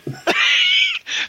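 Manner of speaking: high burst of laughter. Real, not acted. Laughing at Andy.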